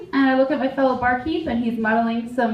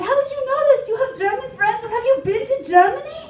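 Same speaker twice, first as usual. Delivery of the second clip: German customers; 11 semitones higher, faster than usual. Retold or imitated. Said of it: "They said".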